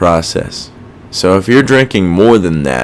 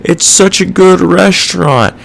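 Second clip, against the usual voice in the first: mock delusional owner; 10 semitones higher, 4 dB louder than usual. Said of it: "Some people are like".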